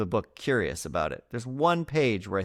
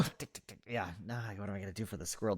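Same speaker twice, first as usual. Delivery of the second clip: hushed panic; 3 semitones lower, 12 dB quieter, faster than usual. Retold or imitated. Panicking over squirrel voice.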